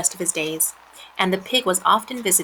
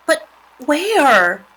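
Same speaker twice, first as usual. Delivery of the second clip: higher, louder pig voice; worried pig; 8 semitones higher, 6 dB louder than usual. A narrator's reading. Wilbur asks departing spiders, higher worried voice. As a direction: ask as an anxious little pig.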